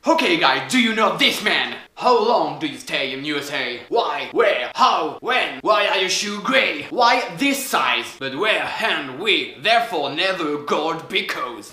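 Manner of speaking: put-on slow, drawn-out interrogation in accented English; American customs officer. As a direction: fire questions like a US border officer, dragging the words. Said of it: "Et la douane américaine". (douanier américain qui interroge)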